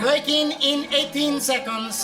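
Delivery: robot countdown. Acted.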